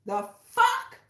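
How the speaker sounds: sharp high "the fuck?"; startled. Real, not acted. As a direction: jump up in pitch, caught off guard. Startled by the reading.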